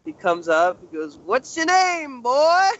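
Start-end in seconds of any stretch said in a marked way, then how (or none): 1.2-2.7 put-on high, slow old southern gentleman drawl; Colonel Sanders voice. Old guard sizing up a young man, slow high drawl. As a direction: draw it out like a pompous old colonel talking down to a kid. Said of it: "freaking Chris calls this guy Colonel Sanders".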